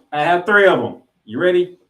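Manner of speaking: ordinary delivery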